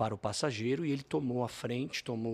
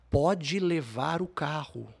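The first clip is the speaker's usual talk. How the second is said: drawn out slow, spelling out the offer; slow it right down, making the point; slow pleading offer; 3 semitones higher, 4 dB louder, much slower than usual. Retold or imitated. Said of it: "cheguei a falar".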